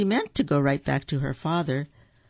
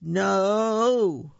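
raised, long drawn-out shout; group yell; 4 semitones higher, 4 dB louder than usual. A narrator's reading. Family all refusing the cashier, group yell. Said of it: "all yelled".

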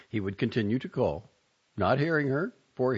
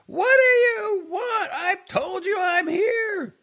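very high, slow screamed outburst; screaming; 8 dB louder, slower than usual. A narrator's reading. Wife finally screaming at husband, very high and slow. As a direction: scream at him in exasperation. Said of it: "she screamed".

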